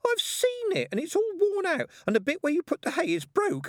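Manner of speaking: put-on very high indignant child; high-pitched childish accusation; snappish little sister. A narrator's reading. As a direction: tattle on a big sister, squeakily. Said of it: "snapped her sister".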